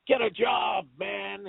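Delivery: high mock shout that drops away; retold yell. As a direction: imitate someone yelling, without full volume. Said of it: "is yelling at the dude".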